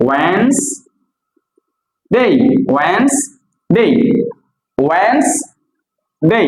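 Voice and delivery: ওয়েডনেসডে split slowly, WENS rising, then DAY; correct pronunciation. Shown as a demonstration. Teacher drilling for learners, correct pronunciation. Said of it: "কি হবে এটা".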